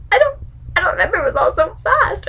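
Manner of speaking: high, rushed mock-tearful voice; whiny mock excuse. Sansa pleading ignorance before the court, mock whiny. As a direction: plead innocence in a hammed-up whimper.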